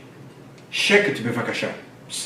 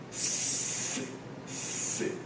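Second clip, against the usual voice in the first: whispered syllables; 17 dB quieter than usual. Shown as a demonstration. Teacher drilling syllables.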